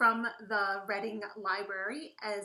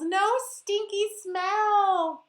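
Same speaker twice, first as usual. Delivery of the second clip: much higher, louder, slow; delighted reveal; 10 semitones higher, 11 dB louder, much slower than usual. A narrator's reading. Narrator celebrating for the kids, delighted. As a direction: savour the happy surprise. Happy story climax.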